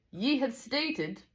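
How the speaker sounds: slow, high Scottish voice; Scottish teacher, high and deliberate; attempted Scottish accent. A narrator's reading. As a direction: draw it out in amateur Scots. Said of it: "I'm not good at a Scottish accent".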